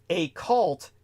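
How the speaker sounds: slow "a cult", the A said as a letter; two separate words. Shown as a demonstration. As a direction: say "a cult" with "a" as its own word, unhurried. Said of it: "then a space".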